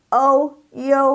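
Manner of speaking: O, U as ou, then iou, held high; built-up iu. Shown as a demonstration. Teacher shows where iu comes from, high and level. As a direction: sustain ou, then blend it into U as "yo-oo". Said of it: "Ini berasal dari I".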